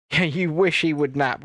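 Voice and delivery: ordinary talk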